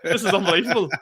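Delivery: mock overjoyed, laughing through it; gushing to Darren about the big gig; excited gush. Retelling his overjoyed reply to Darren, laughing.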